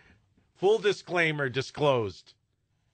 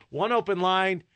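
ordinary talk, nothing marked

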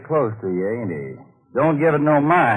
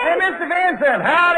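much higher, loud cowboy hail; calling out; 13 semitones higher, 4 dB louder than usual. Acted. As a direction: holler across the gate. Cowboy hails friend at gate.

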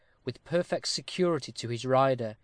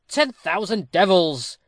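loud, high oath, drawn out; crying out; 5 semitones higher, 7 dB louder, slower than usual. A narrator's reading. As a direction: curse out loud, stretching the words. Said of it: "cried Turpin".

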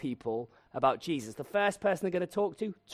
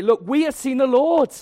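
much higher and louder, bursting; overjoyed announcement; 9 semitones higher, 11 dB louder than usual. Retold or imitated. Disciples reaching Thomas, bursting with joy. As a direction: blurt out the news with joy. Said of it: "they get to Thomas and they say".

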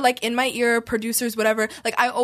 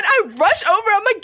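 ordinary talk, nothing marked

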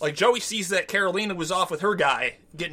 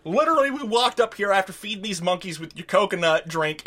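ordinary talk

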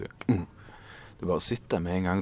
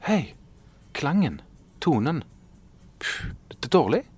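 higher-pitched mock inner critic; scolding himself; 8 semitones higher than usual. Author reproaching himself at the desk, lightly mocking. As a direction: act out the nagging voice in your head. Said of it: "sa jeg til meg selv".

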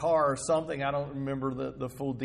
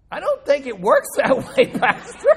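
John laughing; 11 semitones higher, 12 dB louder than usual. Retold or imitated. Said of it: "And John said".

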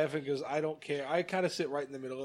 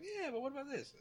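much higher, softer teasing yeah but; mock pot stirrer; 12 semitones higher, 8 dB quieter than usual. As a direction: needle them with a sly question. Stirring both sides of argument.

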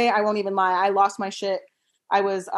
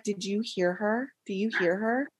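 hushed gossiping neighbor; 6 dB quieter than usual. Retold or imitated. Neighbor to his wife about the yelling mom, hushed. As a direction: gossip under your breath about her. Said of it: "talking to his wife and was like".

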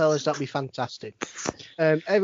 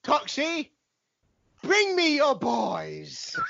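slow, higher, hammed-up summons; performed on request; 8 semitones higher, much slower than usual. Saying the requested line to friends, slow hammed-up summons. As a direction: drag it out like a grand command. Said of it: "can you say, "Bring me front of a boy, please"?".

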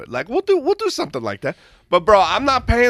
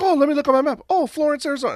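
pitched way up, mock sweet rep; high chirpy rep voice; 12 semitones higher, faster than usual. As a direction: mimic the caller in a high voice. Imitating rep checking map.

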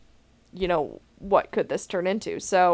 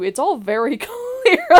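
laughing; 10 semitones higher, 5 dB louder than usual. Real, not acted. Laughing while explaining the recording.

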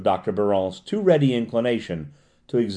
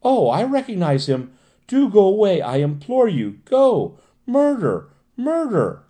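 high, pleading hysterical cries; implore, then climb into drawn-out shrieks; panicked screaming; 9 semitones higher, 4 dB louder than usual. A narrator's reading. Patient eluding her pursuers, screaming in terror. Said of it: "continued to scream".

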